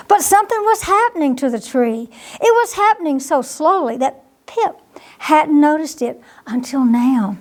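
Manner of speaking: ordinary delivery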